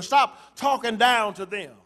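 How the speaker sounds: normal talking voice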